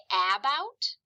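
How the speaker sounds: "about" with a short A as in cat, questioning; mispronounced first vowel. Shown as a demonstration. Teacher questioning the mistake, "about" with short A. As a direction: ask "about" with a full A, not schwa. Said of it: "Does that word say about".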